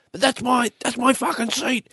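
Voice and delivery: high-pitched angry complaint; indignant whine. Retold or imitated. Aussie passenger arguing over a double-booked seat, high whine.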